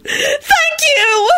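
very high ecstatic squeal; ecstatic yell. A narrator's reading. Daughter thanking dad for the money, ecstatic. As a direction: cry out thanks at the top of your pitch. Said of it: "she yelled ecstatically".

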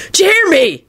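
high-pitched mild scream. A narrator's reading. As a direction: scream it without full volume. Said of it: "the man screamed".

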